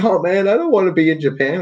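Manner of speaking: high, whining complaint near tears; mock crying whine. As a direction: whimper it like a lost child. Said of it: "I was, like, crying and shit".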